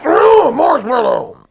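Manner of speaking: high, loud, slow crying wail; hammed-up sobbing cry; crying shout. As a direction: wail in despair.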